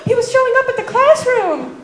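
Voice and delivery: high, gossipy schoolgirl imitation; mock excited friends. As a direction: report the news like thrilled schoolgirls. Friends excitedly report news to girl.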